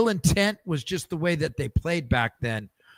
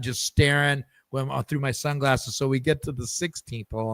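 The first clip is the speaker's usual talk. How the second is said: ordinary delivery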